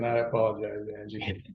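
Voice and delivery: ordinary talk, nothing marked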